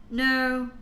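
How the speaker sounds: ordinary talk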